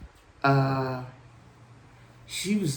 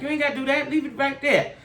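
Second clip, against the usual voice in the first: higher, half-annoyed don't bother; mock exasperated; 9 semitones higher than usual. Telling the tow girl to leave the tire, higher exasperated brush-off. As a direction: brush her off, voice pitched up.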